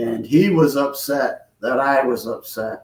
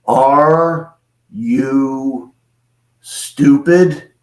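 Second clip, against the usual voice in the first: very slow, word-by-word question; imitating an incredulous tall alien; disbelieving; much slower than usual. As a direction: spell it out in stunned disbelief. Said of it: "he couldn't believe it".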